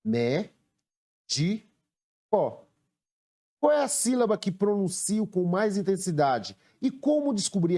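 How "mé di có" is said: "Mé, di, có" with long pauses between; three separate syllables, full final "có". Shown as a demonstration. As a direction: count out each syllable alone. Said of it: "Quantas sílabas há na palavra".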